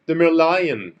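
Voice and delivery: slow, loud, odd theme-park guide voice; weird tone. Shown as a demonstration. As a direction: announce it like a dramatic tour guide. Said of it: "the reason I use that really weird tone".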